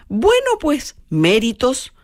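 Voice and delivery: squeaky high, mocking reply; haughty high retort. Marchelina answering Susanna's insult in a high mocking squeak. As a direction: shoot the pitch way up, all airs. Mocking sung duel quotes.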